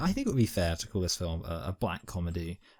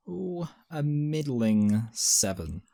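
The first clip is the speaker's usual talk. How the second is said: teasing drawn-out rating; 5 semitones higher, much slower than usual. Real, not acted. Drawn-out pause before own rating.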